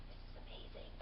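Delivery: hushed, breathless wonder; awed whisper. Retold or imitated. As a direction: whisper it while staring at the view. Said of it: "Now you're going".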